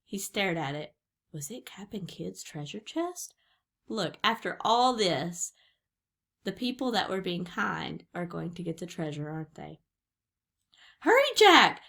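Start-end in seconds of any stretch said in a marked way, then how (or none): 1.3-3.3 soft whispered question; hushed wonder. A narrator's reading. Suspenseful wondering at the discovery. 11.0-11.8 high, loud urgent call; crying out. A narrator's reading. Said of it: "cried Annie".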